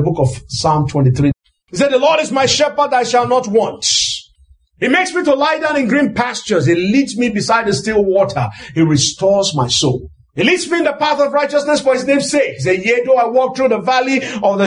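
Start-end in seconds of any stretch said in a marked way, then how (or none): none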